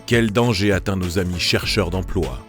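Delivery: normal talking voice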